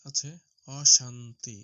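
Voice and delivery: normal talking voice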